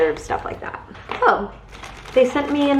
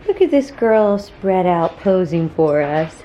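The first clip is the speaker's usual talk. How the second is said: ordinary delivery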